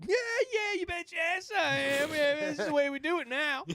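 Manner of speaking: high cocky swagger. Retold or imitated. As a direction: brag it in a high voice. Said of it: "and I'd be like".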